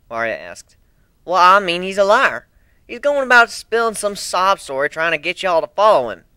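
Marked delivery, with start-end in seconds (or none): 1.2-6.2 put-on Southern accent. A narrator's reading. Applejack accuses.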